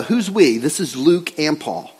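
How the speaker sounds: ordinary talk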